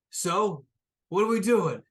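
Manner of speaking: first mate pressing the captains for the plan; eager question. Acted.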